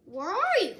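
loud, high searching call. A narrator's reading. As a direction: call into the house for a friend. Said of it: "called Frog".

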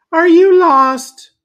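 very high, loud doting lady voice; cooing lady. A narrator's reading. Lady fusses over the lost kitty, high doting voice. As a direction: ask it like a lady fussing over a stray kitten. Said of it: "said the lady".

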